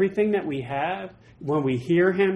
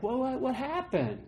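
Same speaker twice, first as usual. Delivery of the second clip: higher, softer, bewildered question; puzzled God; 6 semitones higher, 8 dB quieter than usual. Retold or imitated. God asking where his people went, softly puzzled. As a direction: wonder aloud where they went. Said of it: "And God's like".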